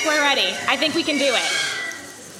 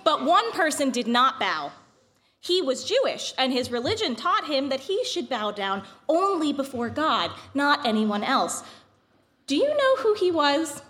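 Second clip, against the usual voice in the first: epic fantasy narrator; 2 semitones higher than usual. A narrator's reading. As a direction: tell it like an old legend. Reads the Purim story aloud.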